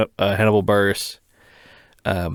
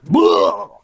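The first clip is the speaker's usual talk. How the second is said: loud mock puke sound, pitched higher; fake retching noise; 8 semitones higher, 4 dB louder than usual. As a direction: spit out a gagging "Bleh" like pretending to vomit. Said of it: "and he just goes".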